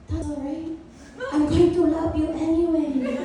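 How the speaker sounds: hushed start, mock motherly reassurance; imitating a forgiving mom; consoling mom impression. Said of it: "my mom finally looks at me and she goes".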